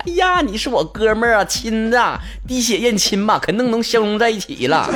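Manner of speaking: put-on high, hammy drunk sweet talk; drunk buddy voice. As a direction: gush like a tipsy best friend. Drunk guy bonding over drinks.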